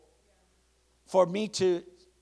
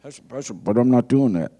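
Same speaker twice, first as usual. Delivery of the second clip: low, loud mock confession; voiced congregant; 5 semitones lower, 12 dB louder than usual. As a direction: own up to the pastor as a congregant.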